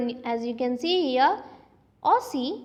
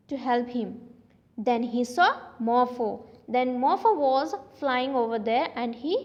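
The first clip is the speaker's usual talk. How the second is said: ordinary delivery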